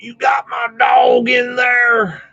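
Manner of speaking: loud, high, drawn-out farmer holler; imitating a gruff country neighbor's shout; old farmer yelling. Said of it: "he was old school farmer".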